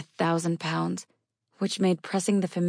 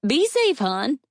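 calling out high and loud from a distance; hollering; 9 semitones higher, 5 dB louder, slower than usual. Acted. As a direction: shout a high farewell down the road. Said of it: "she hollered".

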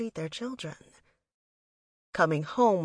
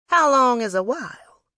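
louder, higher motherly questioning; pointed, raised-voice follow-up question; probing question; 6 semitones higher, 9 dB louder than usual. A narrator's reading. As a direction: press her for an answer, raised voice. Mother presses daughter for an answer.

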